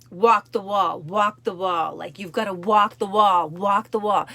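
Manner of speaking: loud, driving coach yell; yelling encouragement. Retold or imitated. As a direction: shout her over the wall. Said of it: "he's yelling at her".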